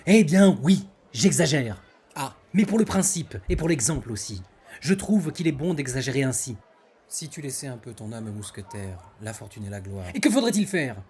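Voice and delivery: loud, high, grand theatrical declamation; hammy stage actor. Acted. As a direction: boom it out like a hammy tragedian. Declaims the non-merci tirade.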